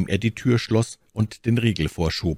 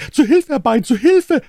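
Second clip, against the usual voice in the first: high, loud, frightened yelling; shrill-pitched servant crying out in alarm; panicked cry for help; 7 dB louder than usual. A narrator's reading. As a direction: scream for help as if facing a murderer. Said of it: "und schrie".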